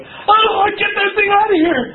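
retold panicked outburst, hammed up; startled recoil. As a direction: jerk back from the spider in the face. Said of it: "puts it right in my face and goes".

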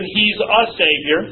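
"a" held and stressed, said slowly; a teacher singling out "a". Shown as a demonstration. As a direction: linger on "a" before Savior. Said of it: "in Luke chapter two eleven".

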